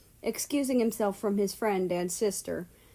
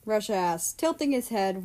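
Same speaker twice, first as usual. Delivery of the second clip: plain reading voice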